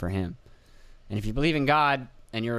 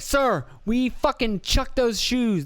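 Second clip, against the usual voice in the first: agent voice; 11 semitones higher, much slower than usual. Retold or imitated. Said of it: "And they're like".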